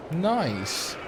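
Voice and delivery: ordinary delivery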